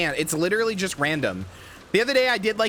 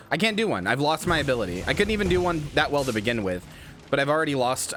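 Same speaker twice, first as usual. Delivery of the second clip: normal talking voice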